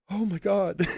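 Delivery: high, loud mock gasp of horror; shocked mother shriek. Mothers in the stands reacting to the son's slide, mock shriek. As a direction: play a horrified sideline mom. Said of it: "all the mothers would be like".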